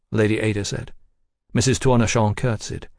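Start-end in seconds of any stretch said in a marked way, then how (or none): none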